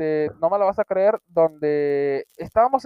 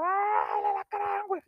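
retold terrified scream; 13 semitones higher, 6 dB quieter than usual. Friend in the park spots the scorpion, panicked scream. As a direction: scream it high and strained, slowly. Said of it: "pegué un puto grito".

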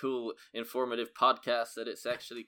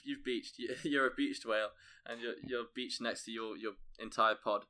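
ordinary talk

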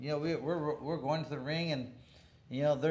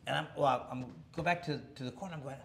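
ordinary talk, nothing marked

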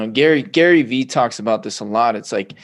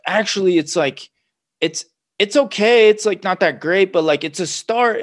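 higher, louder and hesitant; sheepish backpedal; 8 semitones higher, 5 dB louder than usual. Retold or imitated. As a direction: shrink back and hedge, embarrassed. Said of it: "And you're like".

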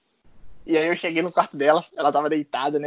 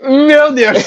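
laughing exclaim; 10 semitones higher, 5 dB louder than usual. Real, not acted. Reacting to host's mock voice.

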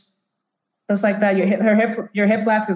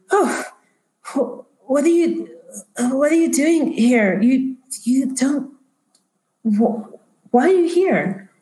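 high, halting bewilderment; startled confusion; 5 semitones higher, slower than usual. Acted. Doctor on guard finding a young girl, startled stammer. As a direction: stammer in surprise, breaking off and restarting.

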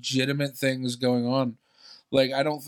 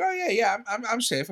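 eager mock reply; 10 semitones higher than usual. Cheerful reply to stranger.

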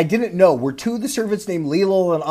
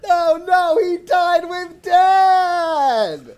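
much higher, sing-song fake crying, drawn out; mock sobbing; much slower than usual. Friend mock-crying over the brother's death, sing-song sobbing. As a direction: sob it out like a hammed-up melodrama.

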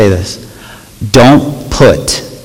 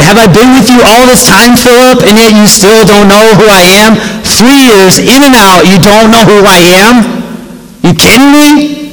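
higher, incredulous rebuke; Jesus in disbelief at Philip, pitched up; exasperated Jesus; 9 semitones higher than usual. Acted. Jesus rebuking Philip, high incredulous disbelief. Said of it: "Jesus replied".

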